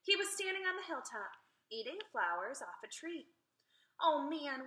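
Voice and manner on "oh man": plain reading voice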